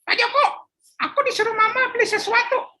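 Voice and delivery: put-on high childish voice; a little boy at the shop counter; young boy. Philip speaking to shopkeeper Pak Joko, high child voice.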